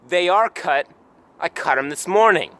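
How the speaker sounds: loud, punchy self-defence; mock indignant. Professor Banks answering a question about uncut fingernails, loudly indignant. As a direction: raise the volume as if affronted.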